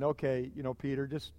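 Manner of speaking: soft and low, gently teasing; a quiet chuckle in the voice; amused chuckling Jesus. Retold or imitated. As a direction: hush down and tease him kindly. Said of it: "Jesus had to just kinda be chuckling".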